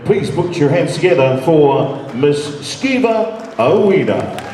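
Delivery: ordinary talk, nothing marked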